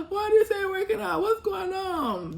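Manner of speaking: high, loud, crying mock plea, slowing down; hammed-up weepy complaint; mock whiny pray-er. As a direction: whine it like a desperate pray-er. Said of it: "And you over here praying".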